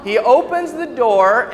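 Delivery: usual speaking voice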